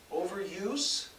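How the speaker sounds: high, soft feeble question; high, soft, feeble answer to his father; feebly. A narrator's reading. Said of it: "Mark answered feebly".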